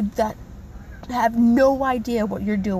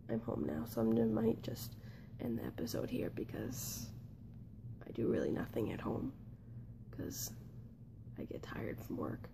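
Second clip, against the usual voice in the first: whispering; 16 dB quieter than usual. Real, not acted. Quiet tired whisper at home.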